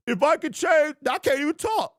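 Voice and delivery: mock-injured fan barely getting words out; hammed-up injured fan. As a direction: pretend your mouth is too hurt to speak. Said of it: "I would have been like".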